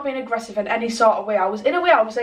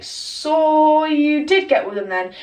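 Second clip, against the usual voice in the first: drawn-out, higher "so you did" call-out; accusing retort; 4 semitones higher, slower than usual. Retold or imitated. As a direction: stretch it out like catching someone lying. Accusing retort acted in story.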